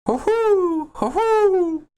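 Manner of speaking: high hooting owl call; owlbear voice. Pretend. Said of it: "what do you think an owlbear sounds like".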